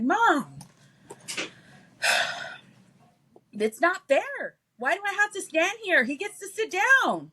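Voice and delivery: put-on high, slow kid whine about fairness; whiny little kid. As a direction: whine like a three-year-old who wants a chair. Son whining, voiced in story.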